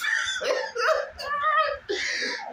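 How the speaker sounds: high crying laugh, nearly a shriek; laughing so hard it brings tears; real helpless howl of laughter; tearful laughter. Real, not acted.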